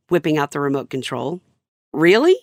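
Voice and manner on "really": confused really. Retold or imitated. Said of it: "the inflection in his voice just then was one of confusion or delight or quite possibly terror".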